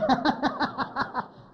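real laugh breaking out high; high burst of laughter. Real, not acted. Laughing at granny's story.

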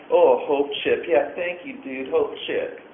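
mock the cheer of a chip; sarcastic mimicry. Mocking the members who get chips, sarcastic singsong.

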